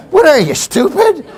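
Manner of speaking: ordinary talk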